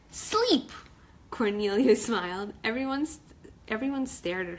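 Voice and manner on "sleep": high, pleased little shout; excited answer. Acted. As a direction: blurt it out proudly. Crab shouts her answer.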